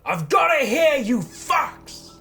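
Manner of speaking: high, loud shout across the trees; bellowed threat to the enemies; yelled taunt. Acted. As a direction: yell it out to draw them. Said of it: "I'm gonna yell out".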